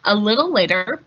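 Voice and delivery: ordinary delivery, nothing marked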